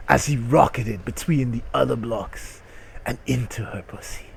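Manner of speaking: ordinary talk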